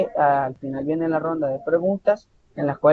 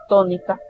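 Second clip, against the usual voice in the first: tónica with the first syllable "tó" hit hardest; tónica stressed up front, "TÓ-ni-ca"; 3 semitones higher than usual. Shown as a demonstration. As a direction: lean on "tó" in tónica. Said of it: "la mayor fuerza de voz se inicia al inicio".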